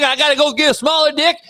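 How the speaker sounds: ordinary talk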